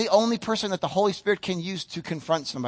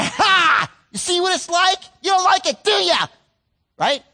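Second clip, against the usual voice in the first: higher, louder taunt; gloating shout; 10 semitones higher, 6 dB louder than usual. Retold or imitated. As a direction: rub it in with a yell. Gloating at a fallen child.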